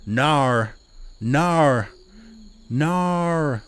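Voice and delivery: exaggerated Aussie "Nar", high and drawn out; Australian accent. Shown as a demonstration. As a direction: practise a hammed-up Australian "no" with an R on the end. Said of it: "let me get my Australian accent".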